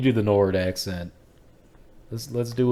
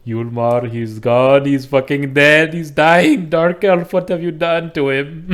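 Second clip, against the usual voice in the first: loud wail climbing higher, mock Indian accent; hammed-up anguished shouting; 6 semitones higher, 11 dB louder than usual. Indian Nord accusing the elf of killing Yulmar, hammed-up shouting. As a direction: cry out in mock horror, building. Acted outrage over a dying comrade.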